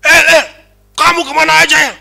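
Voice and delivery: high, loud imitation of a scolding friend; keras, loud-toned friend. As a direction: call out loudly like a rough-voiced roommate. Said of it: "Memang dia nadanya keras". (nada keras, menegur lantang)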